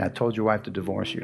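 echoing memory. Retold or imitated. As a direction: hear it echo back inside you. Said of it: "kept hearing my mom saying".

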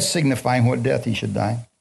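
usual speaking voice

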